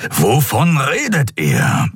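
drawn-out grumbled question, pitch swooping up; Keru, brummend. A narrator's reading. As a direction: grumble it slowly, voice rising. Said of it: "brummte Keru". (gedehnt brummige Frage)

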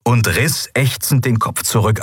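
ordinary delivery

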